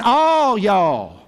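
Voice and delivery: "All y'all" with a broad Texan jɔl and falling close; playful Southern "All y'all". Pretend. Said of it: "he was Texan".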